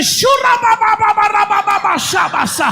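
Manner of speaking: mock glossolalia, shouted high; mock speaking in tongues. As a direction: rattle off fake tongues like a preacher. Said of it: "So I said".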